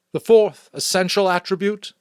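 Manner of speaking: solemn elder's public proclamation; chief elder's address. Acted. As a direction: speak up to the whole community with ceremony. Chief elder continues speech.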